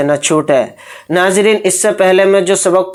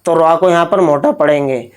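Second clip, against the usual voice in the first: normal talking voice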